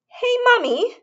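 high, loud bratty call; sudden shout. A narrator's reading. Veruca shouting at her mother, high and bratty. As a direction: call out to Mummy demandingly. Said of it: "shouted Veruca Salt suddenly".

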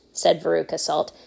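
ordinary delivery, nothing marked

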